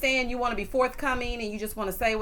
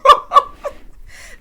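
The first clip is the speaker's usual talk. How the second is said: high, loud burst of laughter; 14 dB louder than usual. Real, not acted. Host cracks up over Kathy not hearing, loud laugh. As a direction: crack up at your own imitation. Laughs at her own imitation.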